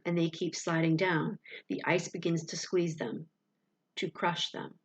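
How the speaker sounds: plain reading voice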